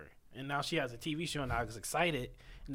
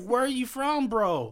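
high, loud puzzled bro; confused dude voice; 7 semitones higher, 6 dB louder, slower than usual. Retold or imitated. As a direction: play a baffled guy asking around. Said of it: "it's like".

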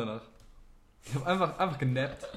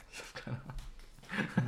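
whispering; 7 dB quieter, much slower than usual. Real, not acted. Embarrassed, asked how many tattoos.

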